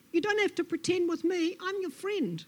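high-pitched placating lizard; soothing Lizard. Acted. Lizard reassures Snake, placating.

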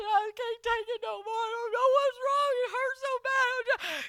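high-pitched tearful wail; crying. Retold or imitated. Calling for help in neck pain, crying wail. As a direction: wail it like a big crybaby in agony. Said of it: "I was crying. I called".

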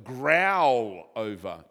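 drawn-out growling delivery; growled. Shown as a demonstration. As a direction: growl it like a lion over prey. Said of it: "which means mutter to yourself".

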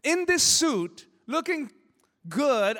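high, drawn-out self-mocking brag; mock pride. Real, not acted. Preacher mocks himself climbing the hill, high and slow. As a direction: stretch it out like showing off. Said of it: "I felt so ridiculous".

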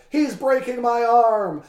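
high, loud, slow wail; hammed-up shout of pain; overacted wrestler howling at the ref; mock anguished cry. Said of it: "Again, he cried".

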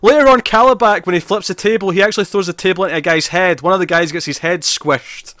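excited fan gushing over a scene. Real, not acted. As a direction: rush in, thrilled by the gore. Excited retelling of gory scene.

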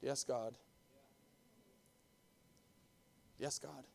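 soft, low and meek; quiet frightened yes; timid answer. Acted. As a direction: answer like a scared child. Timid reply of the three.

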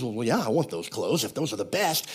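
lightly voiced king, low and soft; gullible king. King answering the man selling clothes, lightly mocked eagerness.

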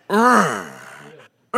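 mock effortful grunting; strained uh, uh; straining grunts. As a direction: grunt like fighting the mower. Imitating holding the mower back.

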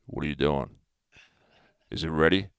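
low, quiet, demanding growl after the gym; a gruff caveman wanting dinner; deep aggressive growl. Retold or imitated. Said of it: "My voice is, like, four octaves lower. I'm very aggressive".

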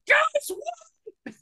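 calling a name shrilly up high across a crowded theater; high pitched call across a packed cinema. Retold or imitated. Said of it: "And then I just go".

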